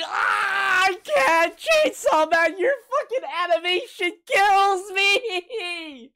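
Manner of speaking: high, loud, drawn-out cry of delight; can't handle how good it looks; overwhelmed shout. Real, not acted. Anime reactor yelling at the animation, overwhelmed.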